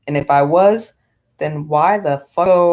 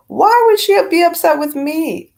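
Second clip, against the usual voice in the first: indignant; 11 semitones higher, 4 dB louder than usual. Retold or imitated. Retold indignant question to boyfriend.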